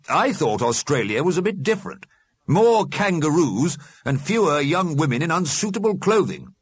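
high, animated character voice; opinionated Alderman. A narrator's reading. The Alderman comments on Australian television, opinionated. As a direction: grumble over what is on screen.

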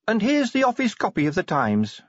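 high-pitched dialogue voice; helpful officer. Acted. Officer handing over the newspaper, high helpful voice. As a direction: hand it over in a lifted voice.

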